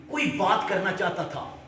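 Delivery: usual speaking voice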